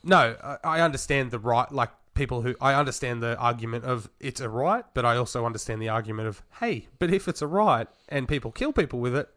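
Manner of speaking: ordinary talk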